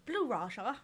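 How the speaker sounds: put-on character accent on "Blue Raja". Fan playing at being the superhero, in his put-on accent. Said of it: "Can you do the accent".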